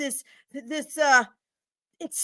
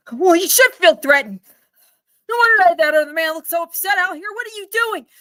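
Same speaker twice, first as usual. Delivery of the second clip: louder angry comeback; menacing retort; 3 semitones higher, 6 dB louder than usual. Acted. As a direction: snap back threateningly. Customer threatens loudly.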